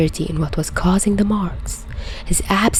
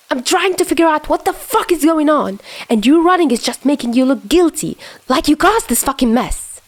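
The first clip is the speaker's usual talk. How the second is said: high, frustrated angry voice; heated arguing; 8 semitones higher than usual. Acted. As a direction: argue it, losing patience. Chuya arguing heatedly with Dazai.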